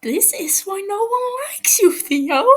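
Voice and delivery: put-on bratty boy jeering at the prisoner, loud and high; scornful snap. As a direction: spit it at him with contempt. Tommy snaps at captive.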